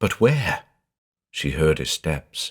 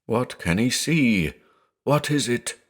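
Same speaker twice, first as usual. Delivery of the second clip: frightened mutter; 5 semitones higher, 3 dB quieter than usual. A narrator's reading. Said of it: "relapsed into a muttering".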